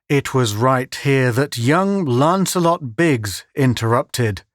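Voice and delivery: usual speaking voice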